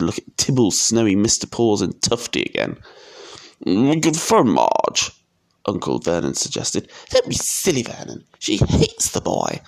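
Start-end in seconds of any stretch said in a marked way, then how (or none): 3.6-5.1 slow, higher uncle character voice; drawn-out suggestion in character; uncle suggesting. A narrator's reading. 7.1-9.6 high, scornful woman's voice; high-pitched scolding wife; dismissive aunt. A narrator's reading. Aunt character dismissing her husband.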